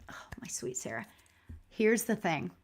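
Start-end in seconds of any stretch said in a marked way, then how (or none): 0.1-1.1 soft breathy whisper, almost no voice; tender whisper. Retold or imitated. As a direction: whisper it lovingly, like a mother to her child. Relaying mom's tender message.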